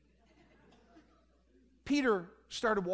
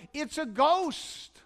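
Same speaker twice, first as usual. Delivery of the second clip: high, slow cry of alarm; frightened yell; 6 semitones higher, much slower than usual. Acted. A disciple in the storm-tossed boat yells in fright. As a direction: stretch it out, pitched high in fright. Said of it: "One of them yelled".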